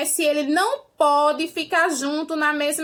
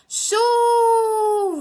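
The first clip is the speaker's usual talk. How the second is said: "Chuva" called high with a long-held first syllable; calling out; 6 semitones higher, 4 dB louder than usual. Shown as a demonstration. Teacher pretending to call the rain at its house, calling out. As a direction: shout for "Chuva" as if at its house, stretching the u. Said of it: "grita por ela assim".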